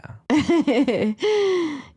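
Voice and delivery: bright high laugh. Real, not acted.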